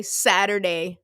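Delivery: usual speaking voice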